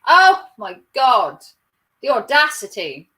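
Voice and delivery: hammed-up scandalised exclamation; mock outrage. Mocking Karens who lack a nanny, mock outrage.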